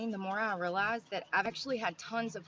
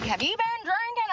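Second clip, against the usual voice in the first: cop voice; 12 semitones higher, 5 dB louder than usual. Retold or imitated. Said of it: "They're like".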